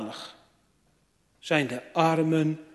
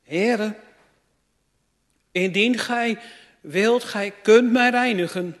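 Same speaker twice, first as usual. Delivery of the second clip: higher, louder pleading on his knees; the leper begging Jesus to heal him, higher and louder; leper's plea; 5 semitones higher, 7 dB louder, slower than usual. Acted. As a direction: beg like the leper kneeling before Jesus. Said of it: "ging voor Jezus op de knieën".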